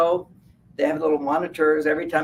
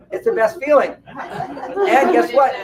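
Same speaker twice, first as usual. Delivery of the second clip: laughing; 9 semitones higher, 6 dB louder, slower than usual. Real, not acted. Laughing, loud.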